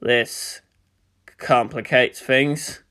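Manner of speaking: low, soft and slow; cold commander. Acted. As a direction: weigh each word coldly. Cold commander's dry remark.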